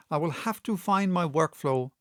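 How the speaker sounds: ordinary delivery, nothing marked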